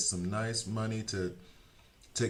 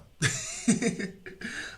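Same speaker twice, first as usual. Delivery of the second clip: high airy burst of laughter; 5 dB louder than usual. Real, not acted. Laughs at own Discord habit.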